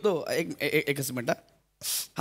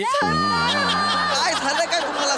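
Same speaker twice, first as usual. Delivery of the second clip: laughing; 8 semitones higher, 4 dB louder, much slower than usual. Acted. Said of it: "laughing shout while asking".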